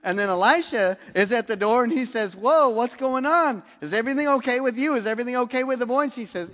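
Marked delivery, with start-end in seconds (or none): 2.3-6.0 higher, louder, rushed questions; alarmed urgency. Retold or imitated. Elisha at the door, quizzing the woman in louder, higher, rushed alarm. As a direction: fire off the worried questions fast.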